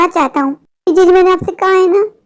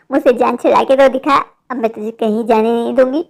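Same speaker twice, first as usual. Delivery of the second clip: much lower, taunting witch voice; menacing witch; 7 semitones lower than usual. Acted. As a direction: taunt him in a low witch voice. Witch threatens trapped man. (बहुत नीची आवाज़ में चिढ़ाती चुड़ैल)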